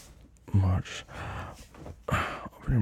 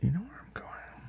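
whispering; 11 semitones higher, 8 dB quieter than usual. Acted. Seductive tease.

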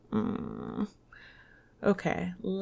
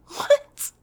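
high loud squeal of disbelief; surprised shriek; 7 dB louder than usual. Real, not acted. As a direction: shriek it out in shock. Laughing outburst at own mistake.

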